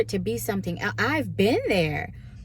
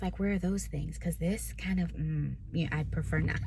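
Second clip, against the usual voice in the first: softer, trailing, half-hearted sulk; mock sulky mutter; 3 semitones lower, 8 dB quieter than usual. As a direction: grumble it under your breath to God, lightly. Retold grumbling prayer to God.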